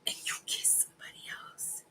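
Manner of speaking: hushed teasing whisper. Real, not acted. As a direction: whisper it like a juicy secret. Teasing partner about the kiss.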